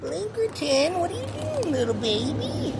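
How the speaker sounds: high cooing pet voice. Real, not acted. Talking to his cat.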